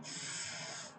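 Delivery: long unvoiced hissed s; snake sound. Shown as a demonstration. Teacher showing pupils the seen sound, snake-like hiss. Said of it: "like snake sound".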